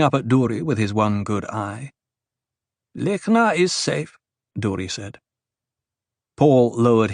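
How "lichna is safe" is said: high, slow reply; Duri's character voice. Acted. Duri reassures Othheim about Lichna, high and slow. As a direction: answer measuredly, pitched well up.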